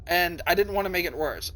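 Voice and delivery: ordinary talk, nothing marked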